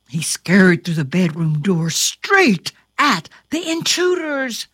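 usual speaking voice

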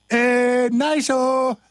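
squeaky high-pitched exclamation; silly high voice. Pretend. Goofing between takes.

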